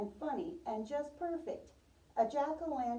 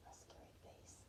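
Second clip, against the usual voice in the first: hushed scary whisper; playful whispered echo for the children; spooky whisper; 24 dB quieter than usual. Pretend. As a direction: breathe it like a secret.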